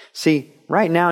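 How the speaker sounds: normal talking voice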